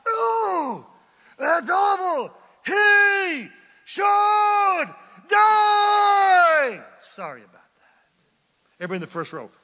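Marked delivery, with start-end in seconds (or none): 0.1-6.8 high, very loud, drawn-out outburst; big volume. Acted. Preacher shouting David's verdict, full volume. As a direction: give it full emphasis and volume. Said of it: "I wanted to give it some emphasis and some volume".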